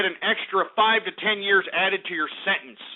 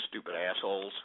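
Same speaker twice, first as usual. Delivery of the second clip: muttered; 14 semitones lower, 12 dB quieter than usual. Acted. Muttered insult aside.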